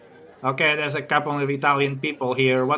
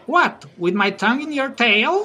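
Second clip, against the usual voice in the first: mild mock shout; 7 semitones higher than usual. Suitor calls after the departing girl, mild mock shout. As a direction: call after her in a half-hearted shout. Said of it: "the guy shouts".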